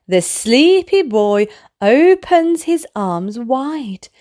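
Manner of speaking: ordinary delivery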